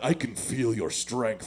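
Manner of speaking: low, slow teasing come-on; drawn-out, low mock-tender line; mock seductive.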